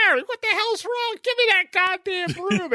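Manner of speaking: very high, strained mock outrage; mock tirade on the edge of laughter; exasperated father. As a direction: imitate an outraged dad, voice squeezed high. Voicing the exasperated father, cracking up.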